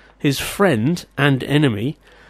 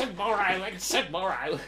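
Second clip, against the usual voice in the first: mock tragic; 5 semitones higher, 9 dB quieter than usual. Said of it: "mocking the Macbeth clip".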